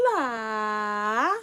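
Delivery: ordinary delivery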